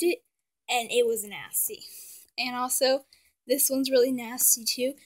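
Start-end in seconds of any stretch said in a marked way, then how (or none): none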